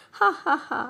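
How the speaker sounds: mock "ha ha", pitched high; staged laugh breaking into an apology; acted laughter. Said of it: "trying to control his laughter".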